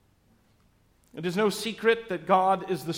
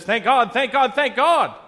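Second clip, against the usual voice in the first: rising, louder each Thank God; a bolder rider spurring his horse faster; brave, building; 7 semitones higher, 9 dB louder than usual. Acted. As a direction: build up the excitement with every call. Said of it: "Feeling a bit more brave".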